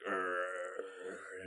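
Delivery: low growling zombie snarl. Shown as a demonstration. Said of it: "Give me a, give me a sample of those".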